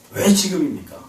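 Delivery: subdued imitation of a complaint, falling at the end; quiet complaint. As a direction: let the protest sink into a quiet question. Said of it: "이렇게 원망의 소리가 나올 때가 있어요".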